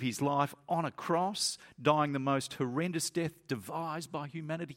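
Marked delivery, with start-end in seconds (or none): none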